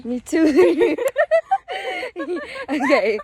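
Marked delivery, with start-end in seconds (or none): 1.0-2.6 high burst of laughter. Real, not acted.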